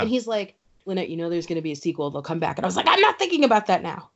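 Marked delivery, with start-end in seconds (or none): 0.8-2.6 quick, low, hushed reassurance; soft and hurried, playing the sensible friend; mock-reassuring friend. Friend soothing her, retold. 2.9-4.1 high, fast retold protest; hammed-up, rushed refusal to be consoled; mock distraught retort.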